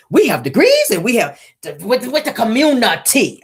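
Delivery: hammed-up imitation of Tisha; imitating Tisha. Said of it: "See how it flew out my mouth?".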